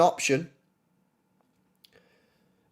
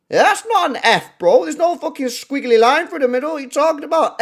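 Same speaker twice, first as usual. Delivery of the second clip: incredulous rant; 9 semitones higher, 5 dB louder than usual. Real, not acted. Ranting at Wikipedia spelling.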